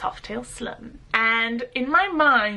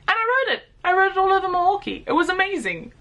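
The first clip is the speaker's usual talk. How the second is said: excited; 9 semitones higher, faster than usual. Real, not acted. Thrilled about riding the bike.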